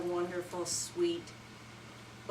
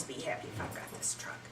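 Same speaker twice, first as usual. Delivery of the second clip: hushed whisper of contentment; boy whispering happily about his truck; 9 dB quieter, faster than usual. Acted. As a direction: breathe it out in a whisper. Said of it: "and he said".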